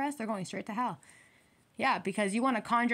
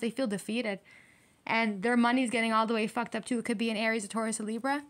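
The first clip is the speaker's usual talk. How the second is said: ordinary talk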